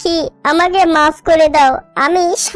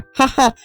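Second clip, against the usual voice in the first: brief sneering laugh; short villainous heh-heh; mocking chuckle; 2 semitones lower than usual. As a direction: chuckle at someone's misfortune. Crow boasting of refusing help.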